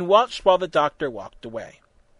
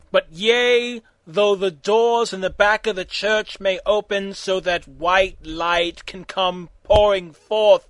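mock preacher; 4 semitones higher, 5 dB louder, slower than usual. Mock sermon sign-off.

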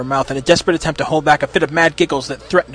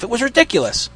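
usual speaking voice

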